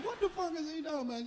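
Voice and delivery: ordinary talk, nothing marked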